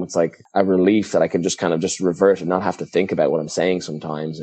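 usual speaking voice

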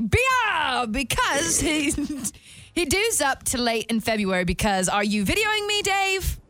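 put-on British accent. Contestant reads a drunk person's rant to Dave, British accent. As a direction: read it in an effortful British accent. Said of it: "this has got the British accent once again".

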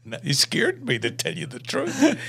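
usual speaking voice

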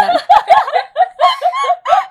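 long high laugh. Real, not acted. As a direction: laugh out loud at yourself. Laughing at their own joke.

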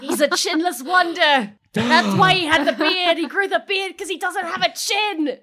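high-pitched taunting exclamation; mocking jeer. Player mocking the unmasked gnome, high-pitched jeer.

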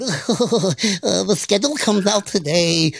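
imitating a giggly little nerd. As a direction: play an awkward nerd at his desk. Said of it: "like a little nerd".

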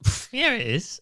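scoffing tipsy retort; mock cocky. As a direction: brush it off like a cocky drunk. Retold cocky reply.

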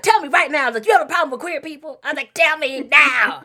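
loud, insistent, overdramatic demand; loud, hammed-up demand that mom confess; dramatic demand. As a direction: lay into mom like a prosecutor. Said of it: "It was so dramatic".

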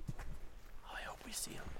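soft hushed whisper. Real, not acted. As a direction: whisper so the orchids stay secret. Hushed while spotting orchids.

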